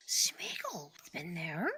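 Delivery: slow, hissing whisper; Gollum whisper. Acted. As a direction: creep it out like Gollum. Keeps doing Gollum.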